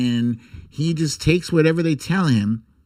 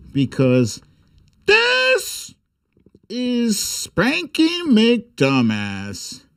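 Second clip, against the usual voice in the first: announcer; slower than usual. Acted. Announcing the next page.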